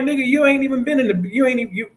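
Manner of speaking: high, incredulous protest; telling a friend he doesn't know the beach; exasperated disbelief. Retold or imitated. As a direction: retell the argument with exasperation, pitched well up. Retelling arguing with partner.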